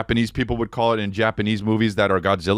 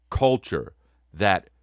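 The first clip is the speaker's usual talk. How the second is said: ordinary talk, nothing marked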